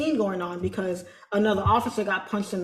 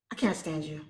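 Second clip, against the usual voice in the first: lower, softer fond grumble; mock exasperation; 6 semitones lower, 8 dB quieter than usual. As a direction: tease her with fake annoyance.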